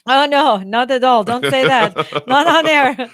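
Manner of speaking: hammed-up cry of denial; mock-alarmed protest. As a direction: protest loudly, as if exposed on air. Mock protest at being called spy.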